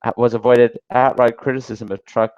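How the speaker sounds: ordinary delivery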